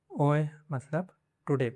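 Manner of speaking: ordinary talk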